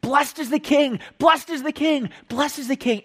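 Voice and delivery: high, repeated chant-like shout; crowd shouting. Acted. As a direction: cheer it like a crowd hailing a king. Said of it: "the people are still shouting".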